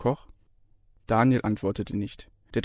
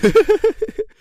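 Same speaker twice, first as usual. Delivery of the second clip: shrill burst of laughter; high laugh; 9 dB louder than usual. A narrator's reading. Lecturer laughs at his own joke, loud and high.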